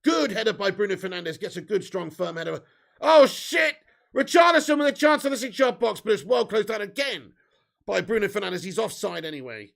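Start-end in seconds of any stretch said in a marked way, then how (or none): none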